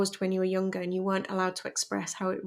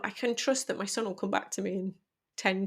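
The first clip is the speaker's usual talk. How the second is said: ordinary delivery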